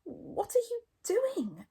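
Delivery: high, drawn-out curious question; puzzled, pitched right up; curious Fishlegs. A narrator's reading. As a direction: ask slowly, full of curiosity. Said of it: "asked Fishlegs curiously".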